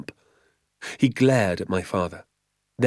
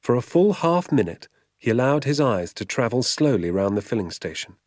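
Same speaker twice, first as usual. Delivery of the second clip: ordinary delivery, nothing marked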